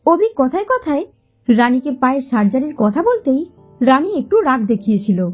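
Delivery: usual speaking voice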